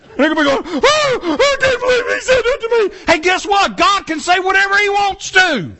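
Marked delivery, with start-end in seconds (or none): 0.8-3.0 a high, fast, hammed-up wail of offended people; mock wail. People wailing after God's verdict. 3.1-5.7 preaching shout. Real, not acted.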